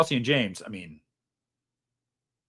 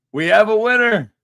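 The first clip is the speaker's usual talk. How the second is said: much higher and louder, a triumphant announcement; loud announcer call; 7 semitones higher, 10 dB louder than usual. Real, not acted. Streamer crowning the winning super chat, loud triumphant announcement. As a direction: belt it out like a game show host.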